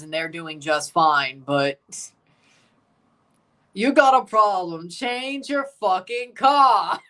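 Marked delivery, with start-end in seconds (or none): 3.7-7.0 loud, raised mock-angry British accent, drawn-out ending; British accent, higher and forceful. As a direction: imitate an irate British team boss, stretching the last words.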